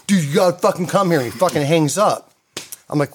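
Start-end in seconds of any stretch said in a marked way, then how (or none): 0.1-1.2 fast, higher, half-turned-away voice; imitating a friend talking to people at the party; Danny talking off the phone. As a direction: rattle it off quickly to the room. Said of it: "he's like".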